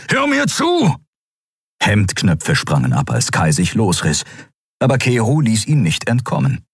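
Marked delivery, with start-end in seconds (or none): 0.1-1.0 very high, forceful shout; urgent shout. Acted. Kero restraining Kai, demanding his attention, urgent shout. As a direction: shout to make him listen.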